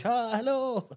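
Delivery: high, soft little greeting; happy baby voice. Acted. Imitating smiley babies, high soft baby voice. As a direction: coo it softly like a smiley baby. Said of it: "They're like".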